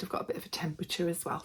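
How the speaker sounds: quick, unvoiced sick whisper; hoarse whisper. Real, not acted. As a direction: rush it out in a lost-voice whisper. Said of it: "I'm a little bit hoarse, losing my voice".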